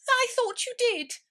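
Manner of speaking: very high, loud curt mouse reply. A narrator's reading. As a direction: squeak back a tart reply.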